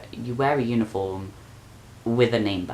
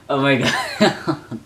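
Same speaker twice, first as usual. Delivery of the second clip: slow, laughing exasperation; 4 semitones higher, 4 dB louder, much slower than usual. Real, not acted. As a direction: laugh through it. Laughing at herself.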